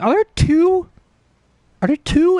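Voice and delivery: high, loud mock disbelief; incredulous question. Retold disbelief at two blobs.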